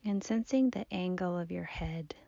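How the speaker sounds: soft, unhurried meditation voice with long pauses; guided body scan. Acted. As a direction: gently guide the listener's attention through the body. Leads a seated body scan.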